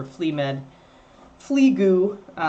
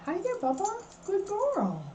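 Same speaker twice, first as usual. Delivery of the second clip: high and soft, cooing; 13 semitones higher, 7 dB quieter than usual. Real, not acted. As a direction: coo gently at the sleepy dog. Greeting the dog softly.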